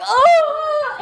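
very high, long 'oh'; acted retching noise. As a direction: wail it out like heaving. Acts out being violently sick.

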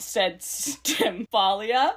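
ordinary delivery

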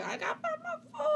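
scramble, words tumbling out; panicked rush. Retold or imitated. Hunting for her phone in a panic, rushed. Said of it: "So then I'm like".

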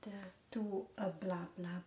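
ordinary delivery

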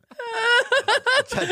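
high, squealing burst of laughter; a shrill laugh that cracks up; shrieking laugh. Real, not acted. Reacts to the accent attempt.

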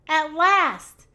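usual speaking voice

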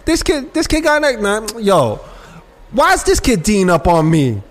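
imitating a trash-talking baller. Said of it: "I've heard it countless times".

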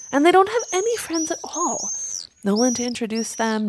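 high, scornful complaint; indignant crow rant. A narrator's reading. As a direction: climb in pitch with disdain. Crow rants about owls.

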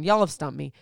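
ordinary delivery

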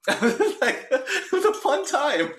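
high, slow, laughing through it. Real, not acted. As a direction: giggle the words out. Laughing while speaking.

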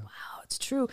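breathy awed whisper. Retold or imitated. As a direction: whisper it in awe.

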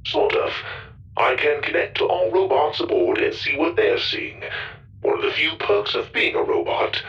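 high, even robot voice. A narrator's reading. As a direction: speak like a friendly ship robot. Goro the robot explaining.

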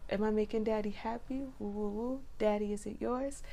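soft, high, coaxing bedroom voice; seductive pleading. Retold or imitated. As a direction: coo it quietly, teasing and eager to please. Acting out seductive talk in bed.

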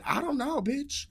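high-pitched, bewildered comeback; squeaky-high acted "how would I know"; exasperated retort. Acted answer to scared friend.

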